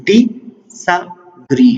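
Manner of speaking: ډي سا ګري as three separate beats, a pause after each; syllable by syllable. Shown as a demonstration. Teacher breaking a word down for learners, ډي سا ګري syllable by syllable. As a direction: slowly split it: ډي, pause, سا, pause, ګري. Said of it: "تھری سیلیبل شول".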